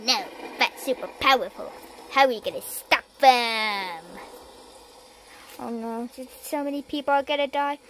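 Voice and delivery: usual speaking voice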